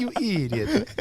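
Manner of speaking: put-on laughing, high Italian accent. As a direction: toss off a giggly Italian accent. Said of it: "say it with an Italian accent".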